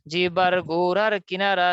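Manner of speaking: জিহ্বার গোড়ার কিনারা spoken slowly, each word clear; slow dictation. Shown as a demonstration. Teacher dictates the rule for students to memorize, slow. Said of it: "আমার একবার বলার পর বলবেন".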